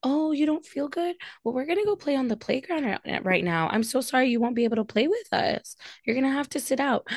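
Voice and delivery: mock preschool teacher; teacher talking to kids. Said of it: "when I'm speaking to my kids".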